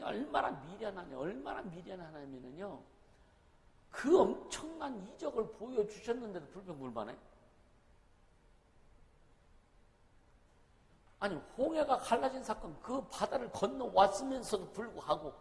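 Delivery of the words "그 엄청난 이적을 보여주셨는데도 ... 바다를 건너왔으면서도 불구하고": impassioned preaching. Real, not acted. Preacher rails at complaining Israel.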